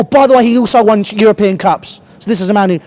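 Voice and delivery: ordinary talk, nothing marked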